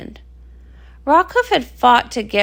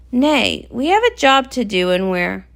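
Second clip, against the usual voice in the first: ordinary talk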